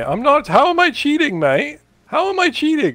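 loud, high denial; angry player shouting his innocence; upset shouting. Real, not acted. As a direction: yell it, furious at being accused. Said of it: "Why are you getting upset?".

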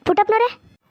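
high call from a distance; arriving and calling out to the farmer. Acted. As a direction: call his name as you walk up. Kencha arrives calling from afar.

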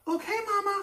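put-on very high little boy voice; child's voice. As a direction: chirp it like a small boy. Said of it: "said Krakus".

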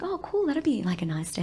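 put-on clueless girlfriend, high and soft; mock naive. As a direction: play dumb and cheerful. Naive reply.

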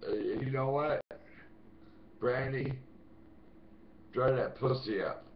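calling her out. Real, not acted. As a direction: call her out crudely. Calling out the stalker again.

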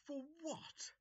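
incredulous. Acted. Incredulous master.

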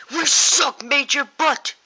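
high, very loud outburst; shouting. Acted. Band member admitting the one problem, shouting. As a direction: yell it out at full force.

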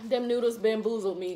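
chewing between words; talking while eating seafood; mouth partly full. Real, not acted. Seen 5: she put food in her mouth at -2; still eating while talking.

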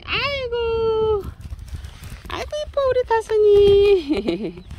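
very high, slow, drawn-out squeal of delight; gushing squeal. Real, not acted. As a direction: melt over the cute cat, stretching each word. Gushing over cat. (고양이가 너무 예뻐 높게 늘어지는 탄성)

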